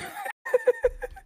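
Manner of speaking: squealing laugh; high shrieking laugh. Real, not acted. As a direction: burst into a shrill laugh. Laughing hard.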